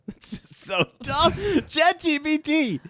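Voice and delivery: high, slow, helpless laughter through the words; real giggling squeal, drawn out; laughing. Real, not acted. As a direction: crack up while despairing at the joke. Cracks up at bad AI joke.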